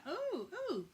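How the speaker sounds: high, swooping flirty oohs; playfully hammed-up come-on; mock flirting. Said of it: "trying to flirt with the, with the doorman".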